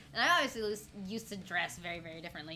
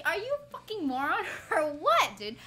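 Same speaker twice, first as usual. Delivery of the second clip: higher-pitched mock outrage with a laugh; exasperated retort; 6 semitones higher, 4 dB louder than usual. Retelling a retort to the ID clerk, exasperated. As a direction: throw it out in disbelief, half laughing.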